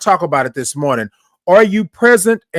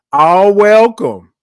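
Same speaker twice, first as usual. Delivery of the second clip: slow, raised, louder proclamation; movie-line imitation; 4 semitones higher, 5 dB louder, much slower than usual. Host quotes a movie to welcome everyone, drawn-out imitation. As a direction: draw the words out as a big announcement. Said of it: "Y'all remember that movie".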